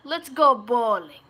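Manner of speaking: loud, drawn-out New York Italian accent; Italian-American accent. Shown as a demonstration. Streamer showing off an accent, loud Italian-American. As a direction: belt it out like a Long Island Italian-American. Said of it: "I can do American Italian".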